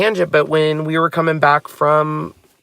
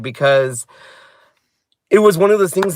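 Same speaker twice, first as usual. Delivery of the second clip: ordinary delivery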